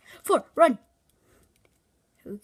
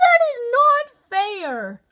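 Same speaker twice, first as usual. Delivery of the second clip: whiny complaint; 9 semitones higher, 8 dB louder, slower than usual. Real, not acted. Unfair trap in the game.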